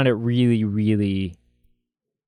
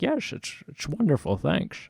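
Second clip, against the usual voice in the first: high, soft, fawning waiter; mock waiter voice; 4 semitones higher, 5 dB quieter than usual. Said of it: "And he's like".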